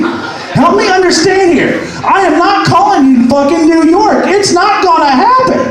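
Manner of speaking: high-pitched exasperated yell; acted-out furious refusal; really pissed. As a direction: lay down the law, pitch way up. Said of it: "I'm getting real pissed".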